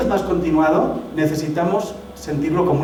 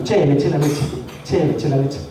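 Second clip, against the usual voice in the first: put-on light Argentine accent with a laugh; hint of an Argentine voice. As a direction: play an Argentine saying "Che" with a chuckle. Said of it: "Imagínate un argentino".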